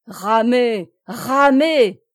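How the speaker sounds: loud, urgent order, climbing on the repeat; frenzied cry. A narrator's reading. Plélan driving his rowers on, frenzied cry. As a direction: shout it like a man in delirium. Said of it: "cria Plélan en proie à une sorte de délire".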